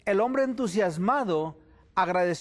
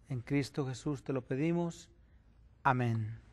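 prayer; 6 semitones lower than usual. Acted. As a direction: pray humbly before God. Opening prayer. (oración reverente y serena)

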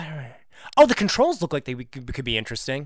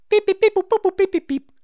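very high electronic beeps by mouth; squeaky, even dial-tone beeps; imitated phone dialing; 5 dB louder, faster than usual. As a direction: mimic keypad tones in quick beeps.

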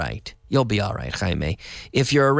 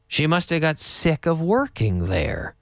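slow, even repeat of "She must have got sick of working there."; model repeat; 3 semitones higher than usual. Shown as a demonstration. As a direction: say it again slowly and evenly.

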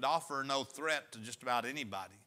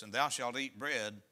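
solemn pulpit reading; scripture reading; 3 semitones lower than usual. Acted. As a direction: read the verse out to the congregation. Reads scripture aloud.

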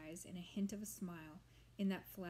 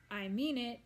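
loud, raised holler; 4 semitones higher, 6 dB louder than usual. A narrator's reading. Old witch hollering at the swamp monster, loud. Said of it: "she hollered".

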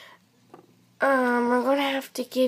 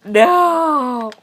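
mock crying no; 3 semitones higher, 7 dB louder than usual. Pet cries refusing.

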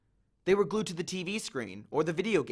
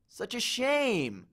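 slow regretful bellhop; 6 semitones higher, much slower than usual. A narrator's reading.

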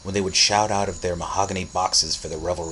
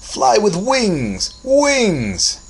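slow, soaring high exultant yell; loud booming shout; 9 dB louder, much slower than usual. A narrator's reading. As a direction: bellow each word, stretched out. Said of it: "loud and deep".

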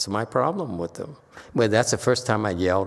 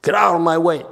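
mock shout; 7 semitones higher, 6 dB louder, faster than usual. Retold heated confrontation.

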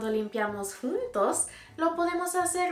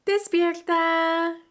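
slow, loud, higher call; loud wake-up call; 7 semitones higher, 7 dB louder, slower than usual. Acted. Teacher calls the kids awake again, slow and loud. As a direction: stretch it out to wake them.

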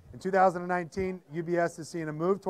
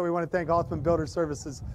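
usual speaking voice

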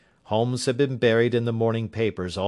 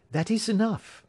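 lightly higher earnest lady's voice; young lady client; 12 semitones higher than usual. A narrator's reading. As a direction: Miss Hunter asking Holmes for his help, lightly voiced higher.

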